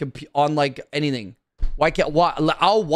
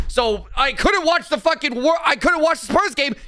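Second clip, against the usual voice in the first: much higher, exasperated cry; angry outburst; 11 semitones higher than usual. Real, not acted. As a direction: let the frustration burst out. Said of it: "that was a quick little rant".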